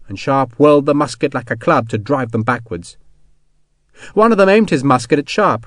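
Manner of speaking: ordinary delivery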